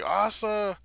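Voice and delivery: high, loud cry of a name; shocked cry. Retold or imitated. Yuru seeing Asa on the floor, loud alarmed cry. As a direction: call out in alarm. Calling out to bleeding girl.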